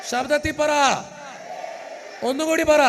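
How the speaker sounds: shouted command to the congregation; rally them, call out loud; preacher shouting to the crowd. Real, not acted. Priest leads crowd hallelujah.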